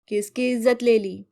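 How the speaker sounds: a call from another room; voice from inside. Acted. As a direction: call out from inside the house, suspicious. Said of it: "इतने में अंदर से आवाज आई".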